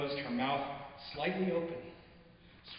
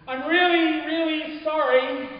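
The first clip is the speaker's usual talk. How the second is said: much higher and louder, stretched out slower; yelled apology; 12 semitones higher, 10 dB louder, slower than usual. Acted. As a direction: call after her, drawing out the sorry. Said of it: "you yell".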